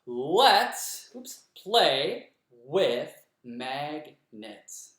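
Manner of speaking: very slow, syllables spaced apart; clapped syllables. Shown as a demonstration. Teacher restarts the clapped line after a slip. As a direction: restart and beat out "play with magnets". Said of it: "You clap".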